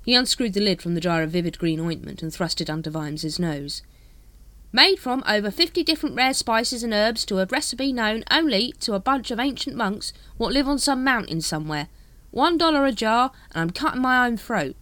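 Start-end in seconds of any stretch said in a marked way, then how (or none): 4.7-14.8 high, loud cockney-ish hawker; street hawker voice. Acted. Dibbler greets the Captain, high hawker voice. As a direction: sidle up like a spiv.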